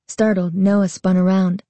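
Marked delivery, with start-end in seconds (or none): none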